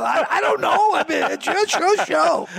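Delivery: high, sheepish retold reply; high mock voice. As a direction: squeak it out helplessly. His own retold reply, high.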